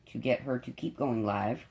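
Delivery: ordinary talk, nothing marked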